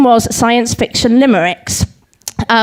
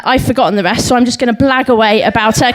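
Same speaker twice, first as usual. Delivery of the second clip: normal talking voice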